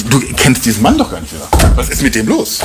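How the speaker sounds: usual speaking voice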